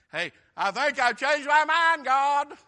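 high, loud comic change of heart; hammed-up Jonah repenting. Jonah in the fish backing down, hammed-up loud plea. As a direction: call up to God in mock desperation.

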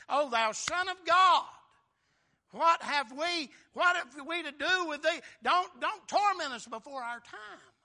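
high, loud crying out; loud plea. Retold or imitated. Preacher voicing demons who fall before Jesus, loud high plea. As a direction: cry out in pleading protest.

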